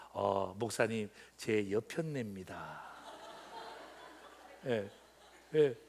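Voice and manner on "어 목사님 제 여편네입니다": polite introduction. Retold or imitated. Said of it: "이렇게 하는 게 아니라".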